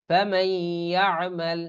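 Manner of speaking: ফামান ইয়ামাল with the n melted into a held nasal y; slow teacher's recitation of ফামান ইয়ামাল; idgham into ya. Shown as a demonstration. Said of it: "তখন কিভাবে পড়তে হয়".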